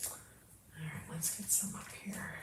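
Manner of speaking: soft whisper to self. Real, not acted. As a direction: whisper it under your breath. Whispering while working quietly.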